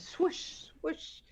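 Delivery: breathy whooshing dodge noises; swoosh sound effect. Retold or imitated. Dodging sounds.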